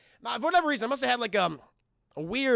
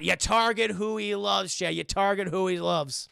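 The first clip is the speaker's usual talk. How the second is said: ordinary delivery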